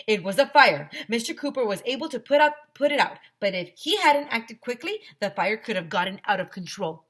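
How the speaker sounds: a hint of a serious principal. Narrator voicing the principal at assembly, mildly stern.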